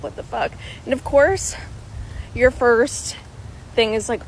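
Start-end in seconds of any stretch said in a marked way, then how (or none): 0.0-0.5 soft, unvoiced aside to yourself; hushed retold exclamation; whispered disbelief. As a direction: whisper it under your breath. Privately reacting to lingering pain.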